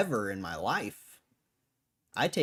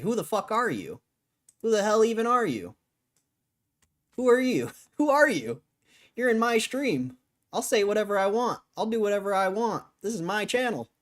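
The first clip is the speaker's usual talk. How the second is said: rising from heated to shouting, much higher and louder; angry yelling; 9 semitones higher, 5 dB louder than usual. Real, not acted. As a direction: blow up at the troll in chat. Streamer yelling at chatter, building.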